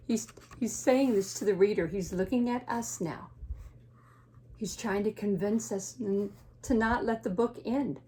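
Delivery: usual speaking voice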